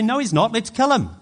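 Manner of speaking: loud, high-pitched mob outburst; defiant crowd. Acted. Preacher voicing the people against God, defiant shout. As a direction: shout down God's verdict as the angry people.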